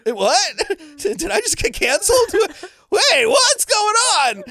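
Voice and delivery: high, loud, laughing disbelief; hammed-up panic, laughing through it; shocked disbelief. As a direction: shriek it out like you can't believe it. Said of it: "And I'm like".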